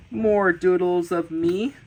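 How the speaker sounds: ordinary talk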